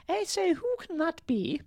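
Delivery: very high-pitched king voice; story king. A narrator's reading. King hears a knock at the door, high put-on voice. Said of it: "said the king".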